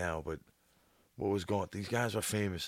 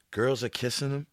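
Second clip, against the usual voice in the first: ordinary delivery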